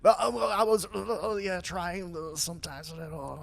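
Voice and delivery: high, halting, raspy politician impression. Asked if he's been practicing, high raspy impression. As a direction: strain it out in raspy bursts. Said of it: "have you been practicing R F K".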